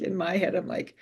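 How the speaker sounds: ordinary delivery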